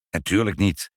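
short grumpy retort; mildly peevish denial. A narrator's reading. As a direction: grumble it back, put out. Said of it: "zei meneer Pinkepank knorrig".

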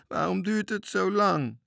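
high, loud plaintive whine; whining. A narrator's reading. Scared sailor longing for home, whining. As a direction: wail it like a frightened little crewman. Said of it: "jammerde Bange Toontje".

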